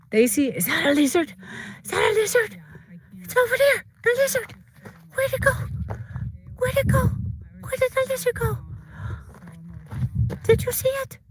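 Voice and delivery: very high, excited sing-song voice for the dogs; pet talk. Real, not acted. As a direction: squeal it to the dogs like they're babies. Excitedly talking to dogs about lizard.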